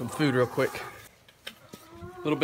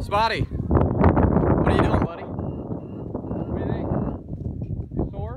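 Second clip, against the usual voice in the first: calling out; 4 semitones higher, 7 dB louder, slower than usual. Real, not acted. Calling to a horse.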